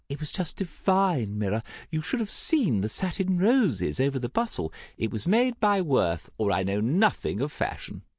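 high, swooping society lady; theatrical high-pitched gossip; effusive gossiping aunt. Acted. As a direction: gush with relish over every detail. Voices effusive Aunt Tessa.

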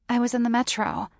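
ordinary delivery, nothing marked

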